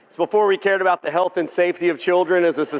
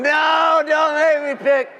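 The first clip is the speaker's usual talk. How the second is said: pleading shout; 10 semitones higher, slower than usual. Retold or imitated. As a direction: yell it like someone begging not to be forced to choose. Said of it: "we got some guys in the back going".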